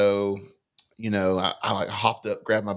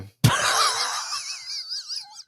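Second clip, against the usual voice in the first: high laugh. Real, not acted. Laughs at own joke.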